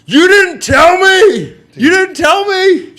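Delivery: high, drawn-out mock yell; loud retold wail of accusation, stretched slow; Kelsey. Teller imitating a climber in the tent, high accusing yell. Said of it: "Kelsey's standing there like".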